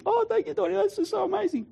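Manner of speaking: very high, tearful thanks; hammed-up weepy gratitude; imitated crying. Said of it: "and she's crying".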